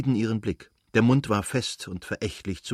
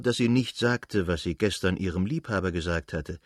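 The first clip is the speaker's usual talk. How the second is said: plain reading voice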